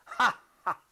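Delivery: short high mad cackle; gleeful laugh. Acted. Madman gloating over the hidden body, high laugh. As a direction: laugh in triumph at your own cunning.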